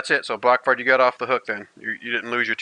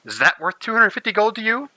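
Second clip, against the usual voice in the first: high teasing seductive voice; flirty tavern woman; 9 semitones higher than usual. Acted. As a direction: tease him like a flirt, high and coy. GM voicing flirty NPC offer.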